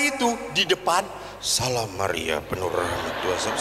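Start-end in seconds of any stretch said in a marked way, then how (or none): 1.4-3.5 low, soft, slowed Hail Mary; frightened prayer. Retold or imitated. Priest recalls praying in the speeding car, low and frightened. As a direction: mutter the prayer in mock fright. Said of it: "Takut saya".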